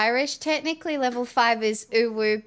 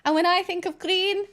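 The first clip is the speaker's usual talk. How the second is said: a lightly put-on Irish accent, a bit high; 4 semitones higher than usual. Streamer praising the keyboard to chat in a light Irish accent. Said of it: "just like my Irish accent".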